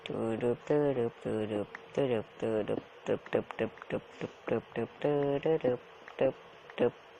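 slow, quiet rhythmic tuk-tuk noises; mouth sound effects. Pretend. Gamer humming a slow, quiet digging rhythm. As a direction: tick steadily like a machine, softly.

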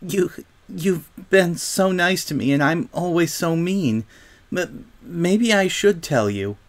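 high, halting, mildly weepy; tearful confession. A narrator's reading. Silver Spoon admits she was mean, tearfully. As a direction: confess haltingly through acted tears. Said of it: "she sobbed".

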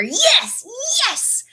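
high, repeated cheers; triumphant yell. A narrator's reading. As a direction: keep cheering, easing off at the end. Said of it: "Ron yelled".

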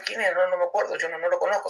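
high, bemused "I don't know him"; imitating himself.

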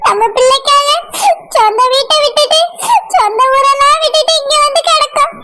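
sobbing between phrases; tearful. Acted. Mother crying to her husband over losing their son, tearful. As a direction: plead through tears, catching breath in sobs. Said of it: "அழாத மஞ்சு".

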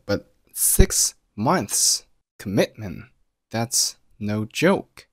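"six months commitment" drawn out word by word, very slowly; slow right down and stress each key word in "that's no joke"; slow emphatic stress. Shown as a demonstration. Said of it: "标黄的单词都做了重读".

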